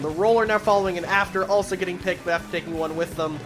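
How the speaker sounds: fast excited commentary; play-by-play. Acted. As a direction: rattle off the action quickly. Live play-by-play.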